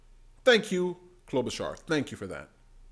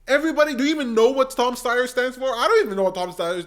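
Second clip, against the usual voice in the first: 1 fast loud rant; 7 semitones higher, 6 dB louder, faster than usual. Real, not acted. Incredulous rant.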